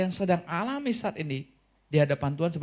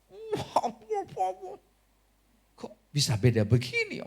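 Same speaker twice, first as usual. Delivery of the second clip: astonished. Acted. Astonished at child's behaviour.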